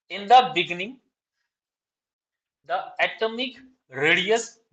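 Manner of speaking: slow, deliberate dictation, each phrase spaced out. Shown as a demonstration. Teacher dictating exam answer to students, slow dictation. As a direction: read out notes word by word for students to copy.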